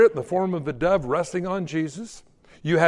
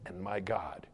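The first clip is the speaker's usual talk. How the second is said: hushed confession; 8 semitones lower, 10 dB quieter than usual. Retold or imitated. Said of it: "Thomas fell to his knees and he said".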